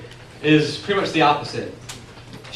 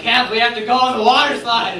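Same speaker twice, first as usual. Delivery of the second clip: put-on higher-pitched eager kid plea; excited kid begging; 6 semitones higher, 3 dB louder than usual. Little brother begging his sister for the slide, excitedly. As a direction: plead high like an excited little boy.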